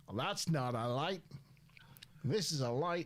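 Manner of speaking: put-on light Australian outback accent; a hint of an Aussie bushman accent; Crocodile Dundee accent. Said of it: "in a Crocodile Dundee voice".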